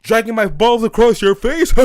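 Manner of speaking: loud, high, hammed-up joke delivery with a laugh; mock the friend laughing at his own joke; obnoxious joker impression, cackling after; imitated bad joker. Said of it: "all you hear this guy is say".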